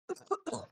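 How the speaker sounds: rough choking cough; coughing up water. Real, not acted. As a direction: clear the water with a hard cough. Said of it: "sorry, one second".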